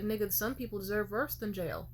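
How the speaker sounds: normal talking voice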